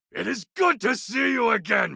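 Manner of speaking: a warlord's hearty welcome; booming greeting. Acted. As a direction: hail an old friend across the camp. Loud joyous greeting of friend.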